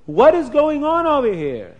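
high, loud outraged demand; a furious king calls out the trick; indignant royal outburst; angry king. Acted. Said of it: "his face turned red".

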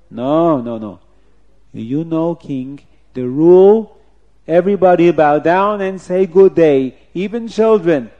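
loud, measured courtly protest; scheming officer, insistent. Acted. Officer reminding the king of the rule, insistent loud voice. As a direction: press the point before the king.

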